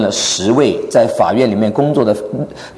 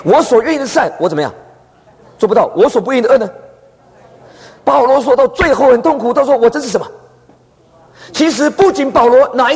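heightened preaching; 10 semitones higher than usual. Acted. Preacher voicing Paul's anguish.

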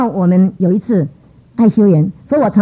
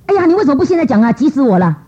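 impatient; 7 semitones higher than usual. Retold or imitated. Inner impatience at delayed answer.

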